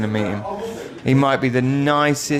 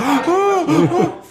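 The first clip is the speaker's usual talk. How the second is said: high, loud mock gasp with laughter; high imitation gasp; 4 dB louder than usual. Retelling the kid caught after falling, high mock gasp. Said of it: "and he's like".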